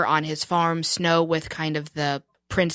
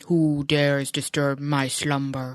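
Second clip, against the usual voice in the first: slow, low booming cave voice; Cave of Wonders voice; 4 semitones lower, slower than usual. Retold or imitated. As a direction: rumble it like the giant cave. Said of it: "it was Jafar... the cave".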